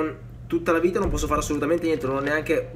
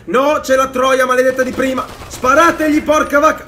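high, loud urgent orders; real alarmed shouting; frantic shout; 6 semitones higher, 6 dB louder than usual. Real, not acted. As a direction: yell at the team to shoot. Shouts as the hag returns.

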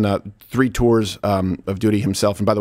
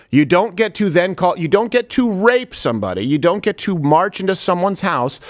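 heated rant; 8 semitones higher than usual. Real, not acted. Host ranting at ceasefire calls, heated and rising. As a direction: hammer the point, building indignation.

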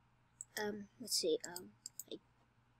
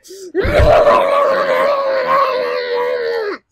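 loud laughter; 6 semitones higher, 13 dB louder than usual. Real, not acted. Laughing after the bacon shout.

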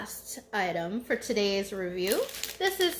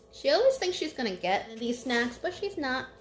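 ordinary delivery

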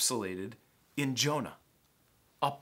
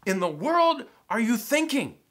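loud, high, incredulous scolding; exasperated sailors; 8 semitones higher, 8 dB louder than usual. Acted. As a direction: berate him like a furious sailor. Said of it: "they're like".

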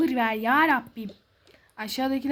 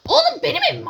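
high, loud indignant yell; angry shout; 11 semitones higher, 6 dB louder, faster than usual. Pretend. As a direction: shout that it's your house. Acted quarrel, claiming the house.

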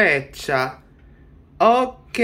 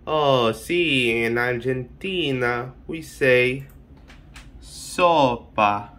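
lightly voiced lower Argentine character; a hint of a deeper Argentine voice; playing Argentina; 6 semitones lower than usual. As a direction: answer as a mock Argentine representative. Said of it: "Argentina, how did you say soup".

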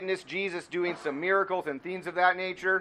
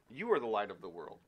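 soft, lower, quicker hushed repeat; hushed proclamation; 8 semitones lower, 9 dB quieter, faster than usual. Acted.

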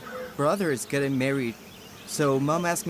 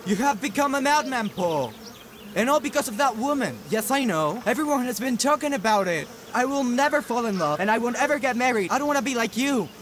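raised, high-pitched accusing voice; angry retort; 6 semitones higher than usual. Acted. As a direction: hurl it back at the boss. Worker lashes back at boss.

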